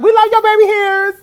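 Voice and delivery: screaming fan. Retold or imitated. Fan yelling a compliment to singer signing autographs, shrieked adoration. Retells same yell to singer.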